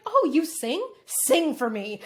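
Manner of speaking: loud, hammed-up pushy fan; exaggerated put-on enthusiasm; mock eager demand. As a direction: imitate someone pestering a performer, loudly. Mimics people demanding she perform.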